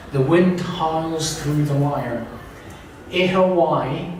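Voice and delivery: measured literary reading; reading aloud. Acted. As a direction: read the passage out deliberately, phrase by phrase. Reads prisoner's account aloud.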